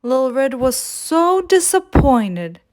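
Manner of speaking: usual speaking voice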